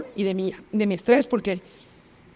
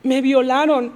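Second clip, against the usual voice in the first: mildly raised, distressed admission; pained confession; 3 semitones higher, 4 dB louder, slower than usual. Retold or imitated. Girl finally telling her friend, openly distressed. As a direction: blurt out the retold confession clearly. First retold disclosure to friend.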